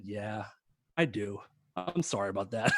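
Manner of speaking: lightly voiced, low and quiet reply; sheepish grinning admission. Singer owning up to his assistant, understated grinning apology. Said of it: "a funny grin and looked at me".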